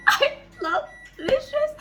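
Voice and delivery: high, slow, teary gush. Real, not acted. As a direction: gush over him. Gushing over a character.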